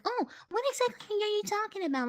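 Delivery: high, quick questioning voice; fast, high mock query; imagined questioner. Voices a questioner, then answers.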